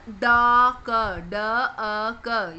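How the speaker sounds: ड, अ, क as slow separate sounds; sounded out. Shown as a demonstration. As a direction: break duck into ड, अ, क, each held alone. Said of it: "यानी कि अ की आवाज आ रही है".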